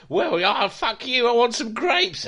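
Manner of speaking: high, loud, bratty defiance; mock defiant reply. Critic parodying Mary's defiance, high and bratty. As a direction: toss it back like a spoilt brat.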